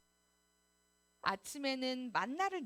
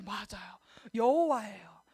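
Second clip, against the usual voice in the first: ordinary talk, nothing marked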